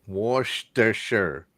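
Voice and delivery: "Worcestershire" very slow, as wosh-ter-sher; attempted WORCESTERSHIRE. Shown as a demonstration. Host copies the friend, "Worcestershire" slowly as wosh-ter-sher. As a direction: draw out "Worcestershire" syllable by syllable. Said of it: "It's Worcestershire".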